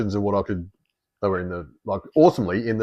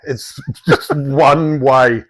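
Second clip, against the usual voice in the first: very slow, raised and emphatic; drawn-out, louder retold protest; exasperated plea; 4 semitones higher, 5 dB louder, much slower than usual. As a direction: spell it out slowly in exasperation.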